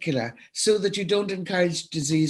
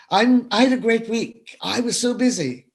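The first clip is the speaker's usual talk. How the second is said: higher, louder proud busy person; mock boastful; 6 semitones higher, 3 dB louder than usual. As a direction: imitate someone bragging about a busy week. Mimics boastful busy people.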